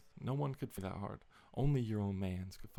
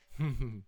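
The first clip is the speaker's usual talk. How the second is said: short laugh; 6 dB louder than usual. Real, not acted. Laughs at the retelling.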